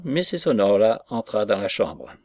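ordinary delivery, nothing marked

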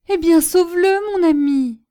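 high, loud, urgent begging; exclaimed plea. A narrator's reading. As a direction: cry out for his life, on your knees. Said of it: "s'écria la fille en se jetant aux genoux". (supplication criée, aiguë et forte)

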